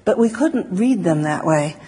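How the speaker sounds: usual speaking voice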